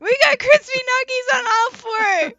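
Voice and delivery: high crying shout; frantic shout. Pretend. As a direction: yell it in mock panic. Mock panic over burns.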